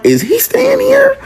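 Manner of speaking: very high, drawn-out worried question; retold freak-out. Nephew privately freaking out about his uncle, high alarmed question.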